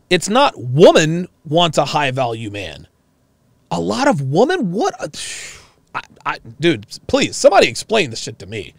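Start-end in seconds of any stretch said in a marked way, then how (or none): none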